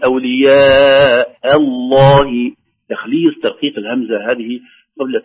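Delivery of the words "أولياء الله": "أولياء" with a light, thin hamza, then a thick lam in "الله", very slow; slow chant of "أولياء الله", the hamza kept light; همزة مرققة قبل لام مفخمة; light hamza before heavy lam. Shown as a demonstration. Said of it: "نقول".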